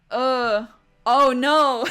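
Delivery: loud, high oh-no; dismayed outcry. Real, not acted. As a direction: cry out in dismay at bad news. Dismayed at the game's turn.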